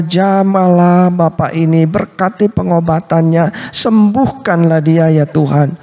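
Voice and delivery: earnest pleading prayer, rising toward God; retold prayer. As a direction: pray aloud for the sick man, imploring. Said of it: "Lalu dalam doanya saya katakan".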